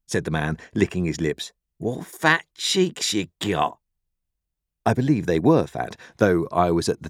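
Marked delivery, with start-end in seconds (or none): 1.8-3.8 slow, higher, drawn-out words; leering convict. A narrator's reading. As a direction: eye the boy like a meal. Convict eyes boy hungrily.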